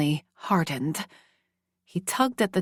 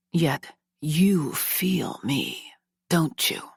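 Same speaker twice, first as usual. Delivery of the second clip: slow whisper; much slower than usual. Acted. Male character close, teasing.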